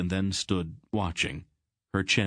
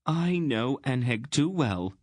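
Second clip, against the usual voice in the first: higher, prim disapproving queen; sniffy; 7 semitones higher than usual. A narrator's reading. Queen doubting her husband's drinking friend, sniffy. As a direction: sniff at the idea with mild scorn. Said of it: "she sniffed".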